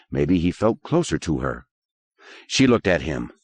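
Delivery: ordinary delivery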